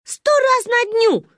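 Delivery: put-on loud, high, drawn-out child's brag; slow, squealed exaggeration; defiant boast. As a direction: throw it back high and loud. Child boasts back in argument.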